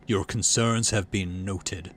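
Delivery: slow, cold, measured dismissal; a menacing calm brush-off; icy dismissal. Acted. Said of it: "he says icily".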